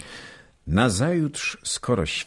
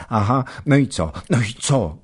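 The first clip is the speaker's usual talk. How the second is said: quick, eager and higher; Baśka pressing for more, fast; eager questions; 7 semitones higher, 4 dB louder, faster than usual. A narrator's reading. Eager wife pressing her husband for the story, quick and higher. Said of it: "dopytując".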